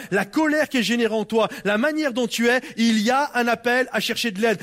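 ordinary talk

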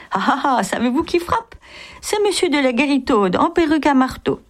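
high-pitched mock laugh carried into the words; acted burst of laughter. A narrator's reading. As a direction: act out laughing as you announce the visitor. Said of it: "puis revint en éclatant de rire".

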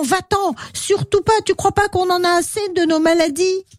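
high-pitched scolding character voice; storybook figure refusing indignantly; dismissive rebuff. Acted. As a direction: shoo the pest away in a high, quick voice. Voices someone rebuffing Pixie.

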